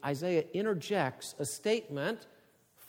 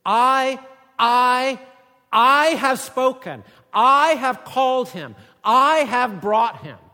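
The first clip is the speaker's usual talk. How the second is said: each I punched loud and high, slow; stress on I; 9 semitones higher, 14 dB louder, slower than usual. Shown as a demonstration. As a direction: hammer every I in I, I, I have spoken. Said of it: "translate this literally".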